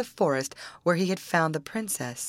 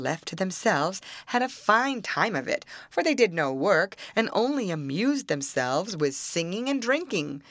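ordinary delivery, nothing marked

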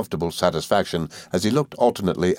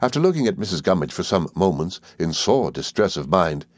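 ordinary delivery

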